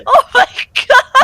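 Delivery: high, loud laughing exclamation. Real, not acted. Reacting to a friend's Beyblade, loud laughing exclamation. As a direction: burst out in disbelief.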